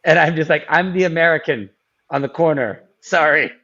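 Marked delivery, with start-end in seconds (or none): none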